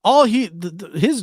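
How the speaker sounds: usual speaking voice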